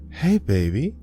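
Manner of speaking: a mother's lilting hello; tender mother. A narrator's reading. Mother's ghost greets her daughter.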